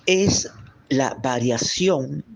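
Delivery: stretched out very slowly, word by word; slow deliberate. Shown as a demonstration. Teacher stressing the definition slowly, drawn out. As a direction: draw each word out so they hear it. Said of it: "escúchelo bien".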